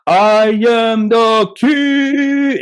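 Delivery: very slow, high booming proclamation; hammed-up dad roaring his rule; dad as a bear. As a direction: stretch it out like a chest-pounding king. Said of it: "He'll go".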